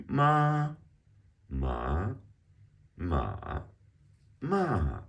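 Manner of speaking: "ma" high level, rising, dipping, then falling; four-tone drill. Shown as a demonstration. Teacher practicing the four tones, "ma" high, up, dip, down. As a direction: sing out each "ma" on its own tone, pausing between. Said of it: "to practice the four tones with the syllable".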